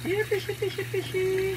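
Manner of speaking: high sing-song calling voice; calling fish. Acted.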